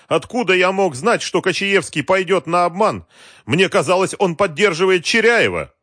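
high, loud, protesting excuse; pleading self-defence. A narrator's reading. Fallen Galkin excusing himself to Wolf, protesting.

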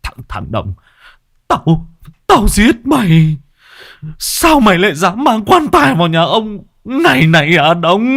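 put-on high, loud old man snarling a threat; furious bald old man threatening to kill; teeth-gritting rage. A narrator's reading. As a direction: grind out the threat through clenched teeth. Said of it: "nghiến răng nghiến lợi".

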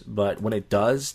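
ordinary talk

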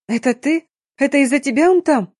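high, agitated accusatory questions; upset daughter's acted accusation; accusing. A narrator's reading. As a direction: confront him, pitch jumping up. Daughter accuses father in story.

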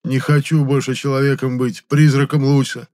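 высокая громкая страдальческая жалоба; ной, будто всё болит; стон боли. A narrator's reading. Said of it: "стон был полон такой боли". (страдальческое нытьё)

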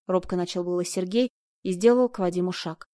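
ordinary delivery, nothing marked

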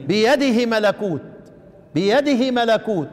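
بيده with the هي cut short; shortened vowel. Shown as a demonstration. Teacher showing the shortened بيده. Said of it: "اقصر او اختلس".

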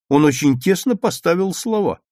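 justifying himself. A narrator's reading. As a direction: plead your case, voice rising high. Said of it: "оправдывался Петя". (оправдываясь, повышенным голосом)

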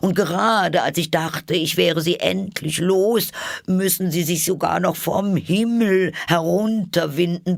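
the bird rising to indignation. A narrator's reading. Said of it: "mit erhobener Stimme fort".

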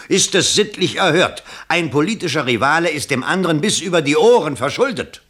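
rising outrage, pitched high and loud; scandalised, loud and high; indignant outburst. Acted. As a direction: explode with moral indignation. Outraged exclamation about rival's debt.

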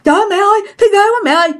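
high, rushed cry for mom; scared calling out. Retold or imitated. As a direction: imitate a frightened kid calling mother. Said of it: "Trời ơi bạn sợ quá".

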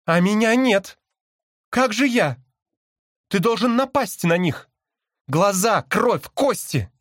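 high, insistent pleading demand; urgent cry. A narrator's reading. Caged sister demands her brother attack, crying urgently. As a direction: press him hard in a high voice. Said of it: "крикнула мне сестра".

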